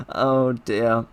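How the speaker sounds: normal talking voice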